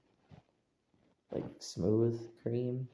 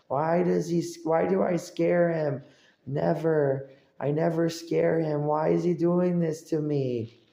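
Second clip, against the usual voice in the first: higher, louder whiny complaining; mock frustrated rant; 5 semitones higher, 7 dB louder than usual. Imitating a grown man who can't scare him, loud whiny rant. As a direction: rant like a baffled bully. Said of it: "and they're like".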